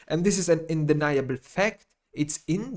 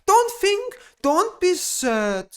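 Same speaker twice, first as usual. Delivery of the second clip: mock soothing falsetto; 13 semitones higher, 3 dB louder, much slower than usual.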